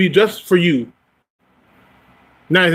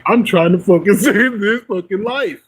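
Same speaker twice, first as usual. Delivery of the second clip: mock masculine side; 5 semitones higher than usual. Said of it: "The fucking masculine side was like".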